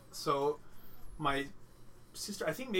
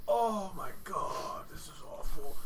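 sick groan; 4 semitones higher, slower than usual. Retold or imitated. Retelling feeling sick, high.

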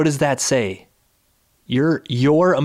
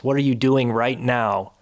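ordinary talk

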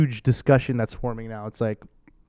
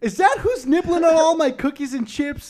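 very high, incredulous questioning; hammed-up discovery in a high voice; mock indignation; 11 semitones higher than usual. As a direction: play indignant, pitched way up. Plays shock at secret housemate.

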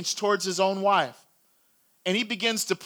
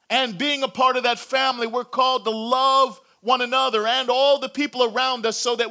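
rising, louder preacher's appeal; preaching call; 4 semitones higher, 5 dB louder than usual. Real, not acted. Pastor calling listeners to join God's family, preaching at full force. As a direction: press the invitation home to the congregation.

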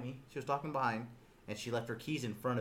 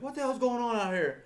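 high-pitched raised voice; hammed-up irritated demand; mock angry demand; 11 semitones higher, 4 dB louder than usual. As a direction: act an officer's startled outburst. Acts Gunny's outburst at the chaos.

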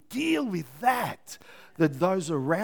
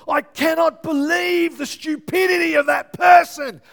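furious shouted rant, much higher and louder; inner outrage; 10 semitones higher, 8 dB louder than usual. Acted. Pastor acting out rage at a stranger, furious shout. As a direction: blow up at the stupidity.

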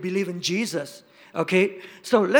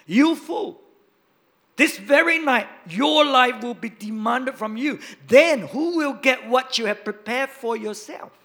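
loud, stern rebuke; God rebuking the rich fool, loud; God's rebuke; 4 semitones higher, 6 dB louder than usual. Acted. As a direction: thunder it down as God's judgment. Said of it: "God said to him".

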